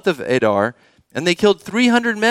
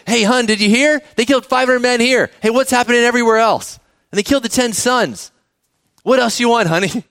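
higher-pitched, mildly hammed-up excitement; excited king; 5 semitones higher than usual. King telling his wife of the victory, excited. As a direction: play a king eagerly sharing big news with his wife. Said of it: "he's excited".

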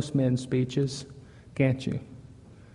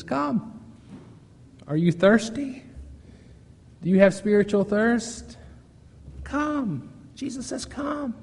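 much higher, gentle calling voice; tender invitation; 7 semitones higher than usual. Retold or imitated. Preacher voicing Jesus inviting the thirsty, tender and high.

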